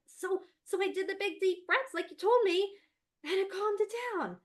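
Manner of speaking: put-on high, loud kid voice; a boy pleased with himself; proud child. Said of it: "And he's like".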